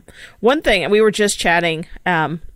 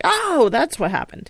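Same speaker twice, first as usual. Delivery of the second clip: sudden realization; 7 semitones higher than usual. Retold or imitated. Viewer reacting to the episode's bombshells, mock sudden realization. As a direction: act out the penny dropping, pitch leaping up. Acting out a discovery.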